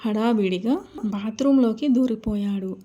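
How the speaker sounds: plain reading voice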